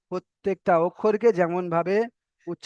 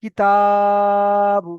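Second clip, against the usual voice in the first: কিতাবু with তা held long and loud, বু short; short কি, long ringing তা, short বু in কিতাবু; elongated TA; 6 dB louder, much slower than usual. Shown as a demonstration. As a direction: stretch the middle of কিতাবু on one sustained pitch. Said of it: "শেষে গিয়ে টান করছি".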